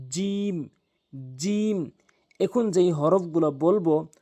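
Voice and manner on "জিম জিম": জিম with tongue middle on the palate; teaching demo. Shown as a demonstration. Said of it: "জিহ্বার মাঝখানের অংশটা উপরের তালুর সঙ্গে".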